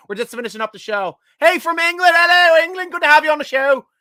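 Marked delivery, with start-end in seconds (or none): 1.4-3.9 hammed-up English accent, higher and louder; terrible English accent. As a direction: greet the English fan in a bad English accent. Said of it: "I got a terrible English accent".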